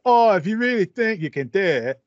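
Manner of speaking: put-on cockney British accent; cockney accent with a scrappy edge. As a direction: play a cockney underdog pulling his family up. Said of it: "you're really on point with that, uh, accent there".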